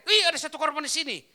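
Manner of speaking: second passerby finding a victim, startled; startled bystander. Retold or imitated. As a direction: jump at the sight of a victim. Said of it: "dia kaget".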